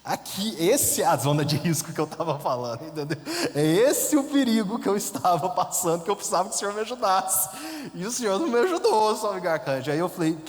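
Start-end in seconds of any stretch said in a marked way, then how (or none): 3.5-9.9 indignant complaint. Retold or imitated. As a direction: scold the saint who let you down. Reclama com o santo. (bronca indignada ao santo)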